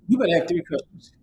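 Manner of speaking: ordinary delivery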